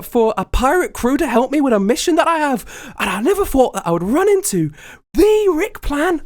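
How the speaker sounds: very high, put-on excited voice; starstruck superfan. Fan tells idol he turned pirate too, high gushing voice. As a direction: gush to a long-lost idol in high pitch.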